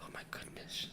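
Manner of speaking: hushed mock awe; whisper. Whispered after mock alarm.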